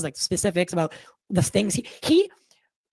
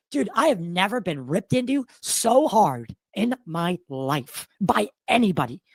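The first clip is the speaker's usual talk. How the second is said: normal talking voice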